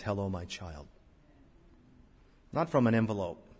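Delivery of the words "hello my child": tender. Acted. God's tender greeting to believer.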